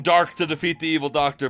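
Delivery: ordinary delivery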